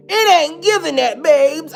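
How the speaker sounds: loud, high-pitched sassy dismissal; emphatic denial. Acted. Storyteller denying she is that depressed, emphatic and loud. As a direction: belt it out to shut the idea down.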